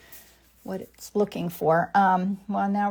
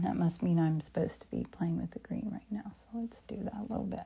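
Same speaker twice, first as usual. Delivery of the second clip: soft whisper to herself; 3 dB quieter than usual. Real, not acted. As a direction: whisper as if painting alone. Whispered painting narration.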